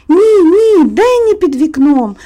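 put-on excited little boy insisting; agitated insistence. A narrator's reading. Little boy contradicts his mother about the window, agitated and insistent. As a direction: protest high and loud at first, then ease off. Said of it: "наполягав на своєму схвильований хлопчик".

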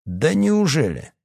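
high, drawn-out sarcastic surprise; slow, mocking lilt. A narrator's reading. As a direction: jeer with fake astonishment. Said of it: "насмешливо произнёс Эдуард".

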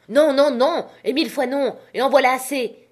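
captain bellowing his refusal, loud and high; shouting refusal. A narrator's reading. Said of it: "cria-t-il".

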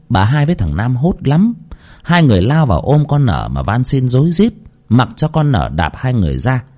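usual speaking voice

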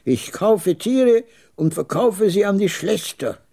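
high-pitched, proud declaration; proud old trader. A narrator's reading. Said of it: "sagte er stolz".

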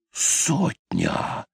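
high, hushed gasp of disbelief; shocked. A narrator's reading. Sailor stunned by the girl's price, shocked. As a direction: ask it stunned, voice nearly gone. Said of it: "потрясённо спросил он".

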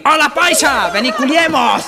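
high, loud triumphant call; mocking shout. Pastuso turning the joke on the paisa, loud and high. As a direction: shout the punchline back in triumph.

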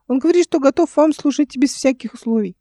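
put-on high child's voice passing on a message; high-pitched boyish report; squeaky boy. A narrator's reading. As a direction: relay it as an eager small boy. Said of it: "писклявый голос мальчика".

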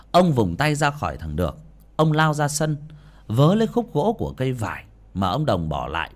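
ordinary delivery

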